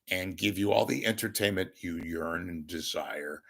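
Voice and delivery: ordinary delivery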